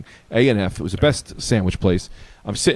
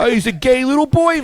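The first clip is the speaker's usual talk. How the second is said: high-pitched mock teasing; teasing buddy; 13 semitones higher, 4 dB louder than usual. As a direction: tease in a hammed-up high voice. Riffing as the neighbor's buddy.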